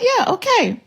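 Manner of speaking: high, breezy yeah okay; wife brushing off husband, high and breezy; mock agreement. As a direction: toss it off lightly up high. Mocking acceptance.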